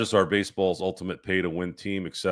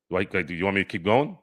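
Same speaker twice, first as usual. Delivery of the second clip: usual speaking voice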